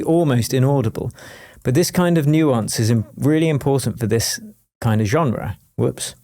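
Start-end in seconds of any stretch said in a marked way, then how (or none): none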